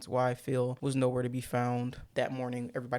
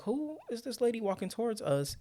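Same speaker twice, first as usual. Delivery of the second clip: puzzled wives; 9 semitones higher than usual. Retold or imitated. Wives reacting.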